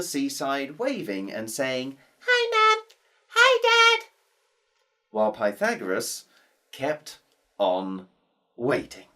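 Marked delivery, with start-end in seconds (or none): 2.3-4.1 put-on high, bright child voice calling to parents; high child's hello from the donkey; little girl calling. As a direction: call out like a small girl waving.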